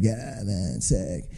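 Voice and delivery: low, quiet stoner-surfer mumble; very soft, deep, laid-back surfer voice; surfer kid. Retold or imitated. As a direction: imitate a barely articulate SoCal surfer. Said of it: "And that's how he talked".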